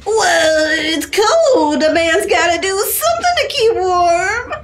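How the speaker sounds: put-on high-pitched drunken excuse; hammed-up drunk self-defence; drunk Santa. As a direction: protest like a tipsy Santa. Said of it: "You're intoxicated".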